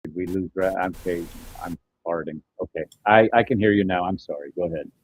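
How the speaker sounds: ordinary talk, nothing marked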